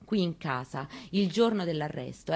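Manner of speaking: ordinary delivery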